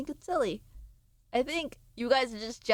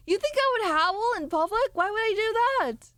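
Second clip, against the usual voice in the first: ordinary delivery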